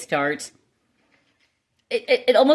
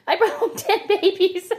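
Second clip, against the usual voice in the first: much higher, laughing through the words; crazy laugh; 11 semitones higher than usual. Real, not acted. Confessing the ten baby chicks to viewers, laughing. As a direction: giggle it out, giddy at your own excess. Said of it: "Yeah, the crazy laugh".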